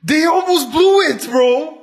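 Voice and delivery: loud, high exclaim; excited outburst. Real, not acted. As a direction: blurt it out, worked up. Arguing about Bills' close game.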